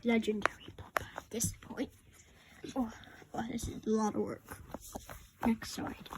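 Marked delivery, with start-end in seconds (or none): 0.0-1.9 whispering. Acted. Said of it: "A S M R time". 5.4-6.1 whispering. Acted. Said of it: "A S M R time".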